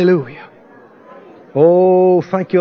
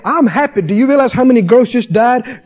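usual speaking voice

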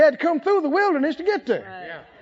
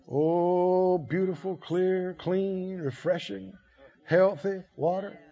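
quiet, lower, lingering over each word; soft savoring; 5 semitones lower, 7 dB quieter, much slower than usual. Acted. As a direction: savor the still waters softly. Savoring image of still waters.

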